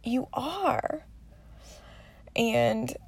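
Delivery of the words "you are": put-on higher, softer child voice pressing the point; insisting little daughter. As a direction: imitate a small girl insisting gently. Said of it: "She's like".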